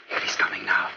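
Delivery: hushed whisper, barely voiced. Tom whispering as the sleepwalker turns toward them. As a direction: breathe it so the sleepwalker won't hear.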